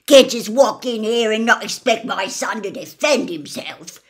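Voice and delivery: put-on aggressive old man. Gaunt hectoring the intruder.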